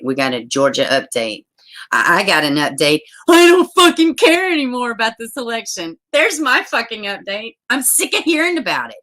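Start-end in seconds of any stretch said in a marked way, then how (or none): 3.3-9.0 high, exasperated outburst; exasperated, pitched-up tirade; fed-up rant. Real, not acted. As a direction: vent loudly, sick of the whole subject. Fed-up rant about election updates.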